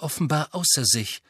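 ordinary delivery

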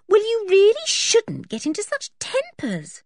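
high-pitched telling-off, pressed home; high, reproachful girl's voice; scolding. A narrator's reading. Anne scolds George for tempers.

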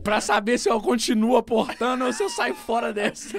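laughing. Real, not acted. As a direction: laughs at his own joke. Laughing about Bitcoin. (falando rindo)

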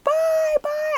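high, loud sing-song bye; fan screaming bye. Retold or imitated. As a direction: wave them off screaming. Screaming goodbye at idols.

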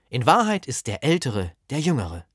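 ordinary talk

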